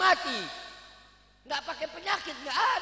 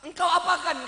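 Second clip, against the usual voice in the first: louder alarmed demand; startled king; 5 semitones higher, 7 dB louder than usual. Acted. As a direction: snap it in shock. (raja terkejut)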